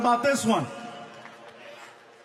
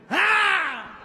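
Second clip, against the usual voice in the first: high shout to scare; mock yell at roaches; 9 semitones higher than usual. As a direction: startle them with a yell. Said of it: "respond to sound and movement".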